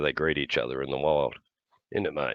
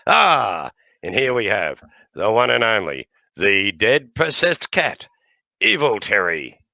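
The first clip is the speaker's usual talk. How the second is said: showman introduction; 3 semitones higher, 5 dB louder, slower than usual. Acted. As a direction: present him like a circus act.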